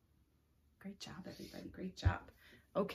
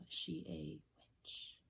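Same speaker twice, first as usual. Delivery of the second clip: slow, hushed spooky question; spooky whisper; slower than usual. Acted. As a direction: whisper it slowly, like a secret.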